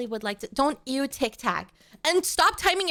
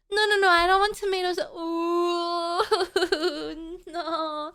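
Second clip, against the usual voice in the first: fake-sob to beg chat to stop; mock whimper; 2 semitones higher than usual. To chat throwing tomatoes, a hammed-up whimpering plea.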